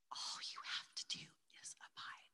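hushed unvoiced whisper; soft whisper. Jesus reassuring the weary believer, soft whisper. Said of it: "what Jesus was saying to me is".

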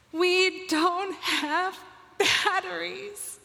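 high, slow crying wail; retelling the boy's sob about batteries, high and slow; imitating a sobbing child. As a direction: wail it like a heartbroken little boy. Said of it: "he totally breaks and loses it".

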